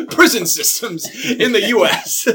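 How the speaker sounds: real laughter breaking through the words, high and loud; helpless giggly shout; laughing. Real, not acted. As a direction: crack up while announcing it. Laughs while naming the topic.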